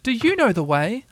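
high-pitched excited question. A narrator's reading. Piglet asking Pooh the way home, excited. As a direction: ask it eagerly in a high little voice. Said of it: "all excited".

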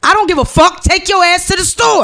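loud, high comic ordering; mock scolding. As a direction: send them off with hammed-up exasperation. Acted order to go to store.

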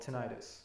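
tinnitus as tuh-NYE-tus; long-I pronunciation. Shown as a demonstration. Reads out disputed pronunciation.

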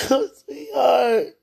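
slow, loud wailing cry; sobbing cry. Real, not acted. Mother crying out to her absent son. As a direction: cry it out in anguish.

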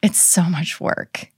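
high, fluttery fake modesty; mock bashful deflection; self-deprecating gush. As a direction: brush off the compliment with a squeaky laugh in the voice. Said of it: "I'm like".

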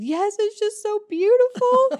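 high, slow, choked-up gush; tearful high voice. Retold or imitated. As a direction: say it as if crying through it. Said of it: "I said".

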